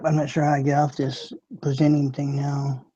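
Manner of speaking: ordinary delivery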